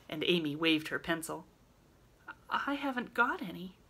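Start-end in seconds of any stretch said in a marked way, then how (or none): 2.5-3.8 forlorn. Acted. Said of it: "said Meg forlornly".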